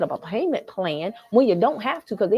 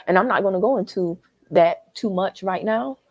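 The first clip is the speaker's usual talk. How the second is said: normal talking voice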